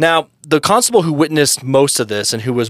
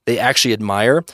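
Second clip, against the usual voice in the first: ordinary delivery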